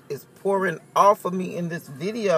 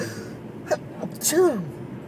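a sudden real sneeze; 6 semitones higher, 4 dB quieter than usual. Real, not acted. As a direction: sneeze sharply. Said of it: "that's my daily sneeze".

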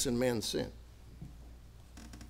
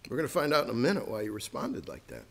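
normal talking voice

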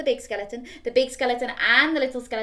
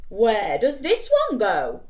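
slow, louder puzzled voice; big skeleton; 7 dB louder, slower than usual. Acted. Big skeleton puzzled, lightly voiced. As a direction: wonder it slowly.

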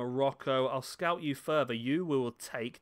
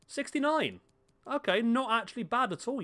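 much higher pitch, surprised reveal; pleasantly surprised; 10 semitones higher, 3 dB louder than usual. Real, not acted. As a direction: react to a better result than you guessed. Reveal beats his low guess.

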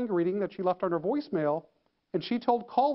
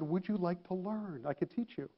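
soft coaxing offer; 9 dB quieter than usual. Retold or imitated. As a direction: offer it gently, almost under the voice.

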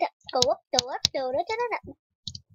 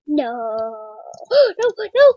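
1 panicked nos; 5 semitones lower, 11 dB louder than usual. Real, not acted. Gamer shrieking as the run fails, loud.

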